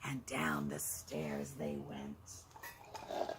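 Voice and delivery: storyteller whispers, slow and soft. A narrator's reading. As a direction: tiptoe the words down the stairs. Sneaking quietly down the stairs.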